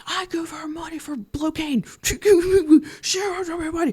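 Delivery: very high, strangled mock scream. Imitating red-faced Pat accusing Melanie, mock scream. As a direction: squeal the accusation without full volume. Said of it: "Pat starts screaming".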